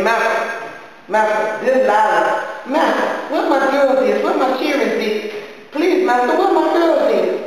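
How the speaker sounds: high, rising plea that builds; mother begging the silent master; pleading. Acted. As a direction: beg the master for her daughters. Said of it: "I kept up so much noise".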